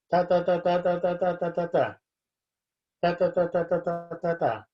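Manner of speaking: monotone, evenly chopped "ta" syllables; robot reading. Shown as a demonstration. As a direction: chant each syllable on one note like a robot. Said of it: "as if we were robots".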